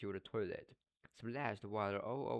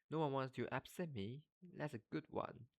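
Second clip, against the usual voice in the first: higher, softer mock wail; a lightly acted tragic howl; 6 semitones higher, 4 dB quieter than usual. A narrator's reading. Myrtle mocking Hermione's comfort, soft mock wail. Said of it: "howled Myrtle".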